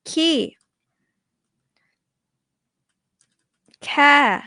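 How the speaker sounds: "Ki" and "Ka" each high, sliding down; falling tone drill. Shown as a demonstration. Teacher shows the falling tone on each vowel. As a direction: drop the pitch on "Ki", then "Ka". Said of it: "You can put any vowel here".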